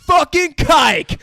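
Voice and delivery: loud, high, slow shouted slur; mock angry yell. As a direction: spit it after her. Voicing Derek's slur.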